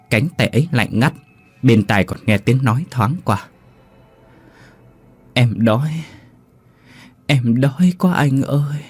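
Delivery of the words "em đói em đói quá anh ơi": put-on ghost child voice. Ghost child begs the narrator for rice, slow eerie plea. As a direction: beg like a starving little ghost.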